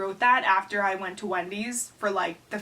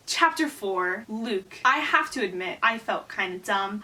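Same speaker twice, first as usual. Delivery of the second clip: usual speaking voice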